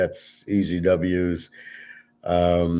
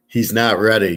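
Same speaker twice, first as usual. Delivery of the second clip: normal talking voice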